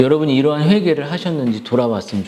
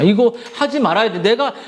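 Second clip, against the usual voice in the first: usual speaking voice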